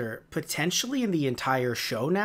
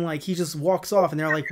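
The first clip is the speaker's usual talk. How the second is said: ordinary talk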